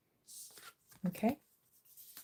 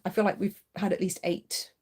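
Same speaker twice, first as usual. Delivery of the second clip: usual speaking voice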